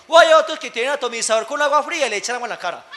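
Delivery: ordinary delivery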